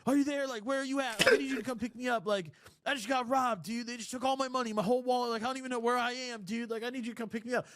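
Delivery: high, rushed panicked voice of a stranded friend. Retold or imitated. Friend on FaceTime begging to be picked up, high panicked voice. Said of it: "got that panic in his voice".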